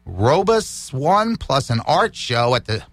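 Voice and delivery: ordinary talk, nothing marked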